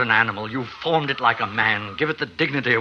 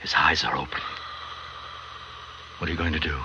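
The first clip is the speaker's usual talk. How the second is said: lower, hushed whisper of dread; frightened whisper; 8 semitones lower, 9 dB quieter, much faster than usual. Acted. Friend sees the creature's eyes open, frightened whisper. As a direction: breathe it out in quiet horror.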